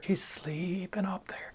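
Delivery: slow hushed unvoiced whisper. Shown as a demonstration. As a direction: whisper it so the wife won't wake. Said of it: "I was trying to whisper".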